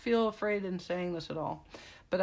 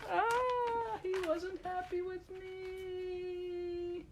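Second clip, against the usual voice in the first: lightly voiced sulky dog, much higher and slow; a hint of Finn's voice; 11 semitones higher, 4 dB quieter, much slower than usual. Owner playfully voices her sulking dog Finn, high and slow. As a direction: let Finn whine that he's left out.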